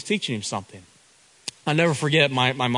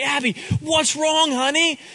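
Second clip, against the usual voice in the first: tender dad; 12 semitones higher than usual. Retold or imitated. Dad comforting crying daughter.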